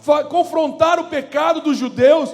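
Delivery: loud, high shouted preaching; full-force preaching. Real, not acted. Preacher exhorting the church, loud high shouting sermon. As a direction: shout the message with fire.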